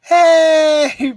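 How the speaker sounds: loud hey to get attention; calling out. Real, not acted. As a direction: call across the room. Calling muted Brandon.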